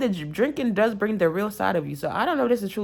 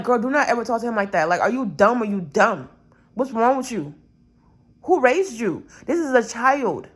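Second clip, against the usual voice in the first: higher and louder, fast indignant rant; angry telling-off; 4 semitones higher, 4 dB louder than usual. Pretend. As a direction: scold her like you're defending a child. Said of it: "I'd have been like".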